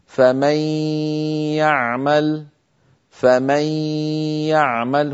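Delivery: فمن يعمل بنون مدغمة في الياء مع غنة ممطوطة; أدغم النون في الياء وأطل الغنة; إدغام بغنة. Shown as a demonstration. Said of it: "مثاله عند النون". (إدغام النون في الياء مع غنة كاملة)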